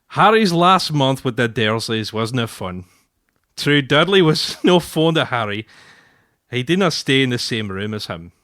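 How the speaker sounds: broad put-on Scots accent; Scottish accent. Shown as a demonstration. Husband reading a Scots Harry Potter excerpt to his wife, Scottish accent. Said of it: "Is it a good Scottish accent?".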